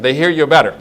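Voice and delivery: ordinary delivery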